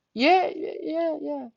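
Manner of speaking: high, fading, repeated yeahs; retold meek agreement; anxious confirming. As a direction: imitate a timid, hurried answer. Girl answers under pressure.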